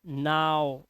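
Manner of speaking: low, drawn नाव with 'ah-oo' glide; 'ow' pronunciation. Shown as a demonstration. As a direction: say नाव to rhyme with cow. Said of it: "क्या पढ़ते हैं?".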